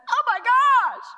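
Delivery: high, loud mock freak-out; mock panicked exclamation. Preacher mocking a panicked reaction to trouble, high mock alarm. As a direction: squeal it like a panicked believer.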